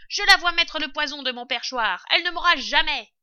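high, loud boast; defiant shout. A narrator's reading. Rat bragging she'll never poison him, shouting defiantly. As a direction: crow it from the perch.

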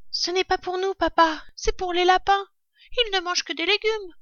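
put-on very high-pitched child voice; littlest fox cub. Cub telling father about the rabbits, high little voice. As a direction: explain it in a tiny piping voice.

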